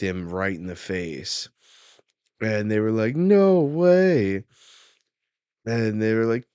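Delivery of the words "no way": loud, high mock disbelief; shocked exclamation. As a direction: cry out like stunned onlookers. Acted crowd reacting to the slap.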